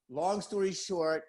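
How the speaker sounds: ordinary talk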